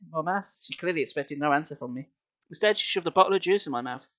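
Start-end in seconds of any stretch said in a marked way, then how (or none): none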